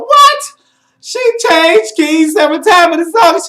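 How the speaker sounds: shrieked, very high comic incredulity; outraged disbelief. Acted. Reviewer reacting to a singer getting through, outraged disbelief. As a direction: screech it in mock outrage.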